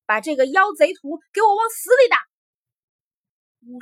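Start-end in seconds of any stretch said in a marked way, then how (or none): 0.1-2.2 loud, high-pitched enraged command; furious shout. A narrator's reading. Enraged emperor ordering warriors to beat him, shouting. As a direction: roar out the order in rage. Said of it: "只喊了一句".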